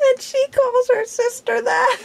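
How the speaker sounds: can't stop laughing; giggling through the line. Real, not acted. Still laughing at the story.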